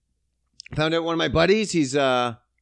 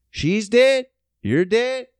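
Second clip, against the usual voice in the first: emphatic declaration; 5 semitones higher, 5 dB louder than usual. Acted. Cutting off friend, emphatic.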